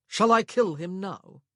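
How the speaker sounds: slow, loud menacing threat. A narrator's reading. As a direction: threaten him coldly. Husband's dark threat.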